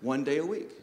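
a quiet, weary mock complaint; mock stressed grumble. Preacher mimicking a stressed listener, quiet and weary. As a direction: sigh it out like a frazzled worker. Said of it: "have to say".

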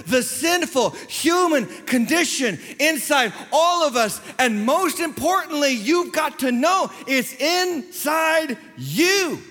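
high, slow, weighty pulpit climax; impassioned drawn-out declaration; full-force preaching. Real, not acted. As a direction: hammer it home word by word. Preacher drives home the refrain.

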